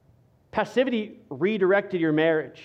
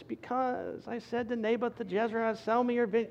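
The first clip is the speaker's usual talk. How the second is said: high, breathless whine of a sulking king; whiny Ahab; 5 semitones higher, 7 dB quieter than usual. Retold or imitated. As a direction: whine it like a pouting child, hushed and nasal-free. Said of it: "in a whiny voice".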